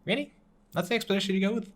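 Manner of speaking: disbelieving rise on the question; mock scoff at a weak excuse; quoting own reaction, disbelieving; incredulous. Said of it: "and I'm like".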